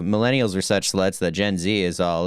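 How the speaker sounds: ordinary talk, nothing marked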